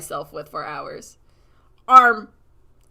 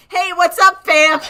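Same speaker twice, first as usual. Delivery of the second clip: high, loud chummy greeting; cheery bird voice; 10 semitones higher, 13 dB louder than usual. Retold or imitated. Bird greeting the scared character, cheery loud. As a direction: chirp it like a cocky buddy. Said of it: "He's like".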